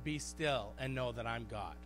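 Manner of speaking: low, soft and patient; calm reassuring God. Retold or imitated. Preacher voicing God to a worn-out believer, soft and calm. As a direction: settle a tantrum gently. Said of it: "God's like".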